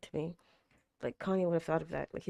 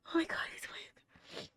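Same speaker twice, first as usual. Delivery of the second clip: high, breathy whisper of awe; awed whisper; 11 semitones higher, 9 dB quieter than usual. Real, not acted. Stunned the character is awake, a high awed whisper. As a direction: barely breathe the words.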